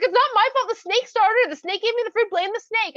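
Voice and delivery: high, loud, defensive squawk; frantic blaming. Acted. Woman points at the snake before Hashem, frantically blaming. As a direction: protest like a child caught out.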